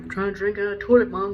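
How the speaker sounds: fast, loud dog voice; dog's voice. Retold or imitated.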